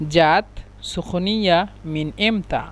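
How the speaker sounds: জাত সুখনিয়া মিন ইমতা said slowly, each word apart; slow it right down for learners; slow Egyptian dialect demo; slow repeat. Shown as a demonstration. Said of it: "মিশরের আঞ্চলিক ভাষা হলো".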